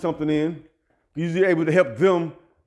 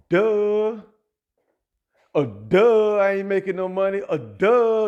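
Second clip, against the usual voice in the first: mocking duh; 3 semitones higher, slower than usual. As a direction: play dumb to mock them. Mocking reply to the gossip.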